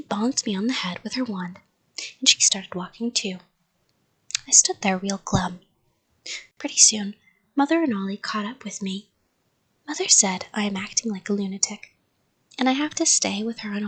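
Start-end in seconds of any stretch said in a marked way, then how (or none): none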